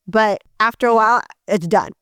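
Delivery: usual speaking voice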